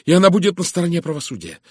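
high, loud, ringing declaration; proud resolve. A narrator's reading. Miss Howard vows to stand with justice, proud resolve. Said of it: "Женщина гордо вскинула голову".

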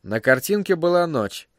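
ordinary delivery, nothing marked

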